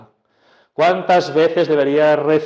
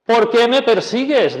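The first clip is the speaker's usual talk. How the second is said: pregunta alta de Jesús; 9 semitones higher, 4 dB louder than usual. Acted.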